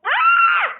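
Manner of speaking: high surprised gasp; tiz bir şaşkınlıkla bağır; şaşkın çığlık. Real, not acted. Oyunda bir şey görüp şaşırıyor. (çok tiz şaşkın çığlık)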